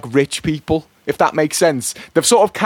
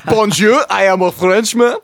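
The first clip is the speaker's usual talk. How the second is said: higher, hammed-up French accent; 6 semitones higher than usual. Co-host teased about accents, mock French accent. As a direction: announce yourself in a comic French accent. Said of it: "the nationality of the accent that you're doing".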